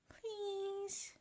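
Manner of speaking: very high, soft, drawn-out little plea; pleading child. A narrator's reading. Boy begging Grandpa for snow, pleading. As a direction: wheedle sweetly in a tiny voice.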